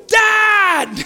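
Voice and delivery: high, loud gleeful "Dad!"; excited child shout. Pretend. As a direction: call out like a delighted little boy. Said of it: "My son might go".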